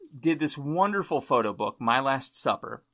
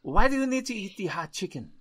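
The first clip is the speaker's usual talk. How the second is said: put-on French accent, pitched up; 5 semitones higher than usual. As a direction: imitate a French chef with a French accent. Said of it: "that was pretty good French accent".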